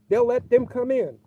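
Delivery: ordinary delivery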